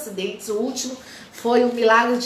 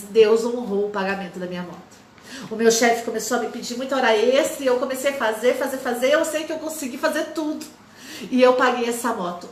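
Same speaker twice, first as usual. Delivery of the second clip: ordinary delivery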